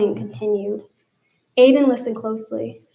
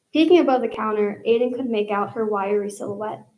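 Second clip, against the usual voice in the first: ordinary talk, nothing marked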